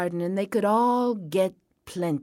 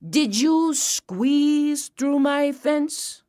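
higher, slow drawn-out question; suspicious farmer, pitched up; interrogating farmer; 7 semitones higher, 3 dB louder, much slower than usual. A narrator's reading. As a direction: stretch out "squeeze".

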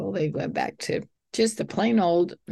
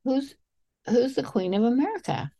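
put-on high, curious little girl voice; little daughter's question; 8 semitones higher than usual. Dad retelling daughter's plane question, lightly childlike. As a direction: lightly voice a young girl asking.

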